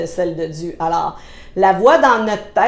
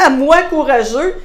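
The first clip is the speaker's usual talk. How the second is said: mock disbelief; 4 semitones higher, 4 dB louder than usual. Gideon replying as if on social media, laughing disbelief. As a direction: laugh first, then an incredulous raised line. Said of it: "notre petit emoji de là, là".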